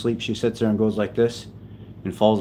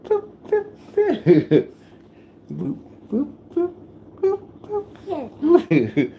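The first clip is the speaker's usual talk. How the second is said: tiny squeaky high boops; short high-pitched pops of sound; playful boops; slower than usual. Pretend. Dad booping his baby for laughs, playful high squeaks.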